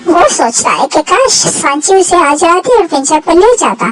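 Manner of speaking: bright, high storyteller voice; story narration. A narrator's reading. Narrator closes the episode for young listeners, high lively telling. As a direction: tell the happy ending to children.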